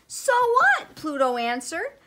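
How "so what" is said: very high, loud comeback; defiant retort. A narrator's reading. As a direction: throw it back at them indignantly. Pluto retorts to astronomers.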